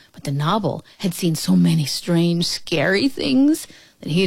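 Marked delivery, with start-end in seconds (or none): none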